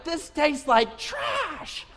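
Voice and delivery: high, slow, drawn-out whine; mock disgust. Kids complaining to dad about seaweed, disgusted. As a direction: wail it like a revolted kid.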